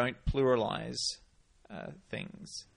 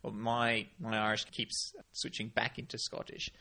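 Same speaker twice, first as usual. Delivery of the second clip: ordinary talk, nothing marked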